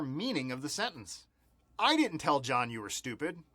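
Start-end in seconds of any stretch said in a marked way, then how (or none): 1.8-3.4 I hit hard up front, rest falling back; stress on I. Shown as a demonstration. Showing learners how emphasis changes meaning, stress on I. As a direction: lean on the opening word I. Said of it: "change the word that we emphasize".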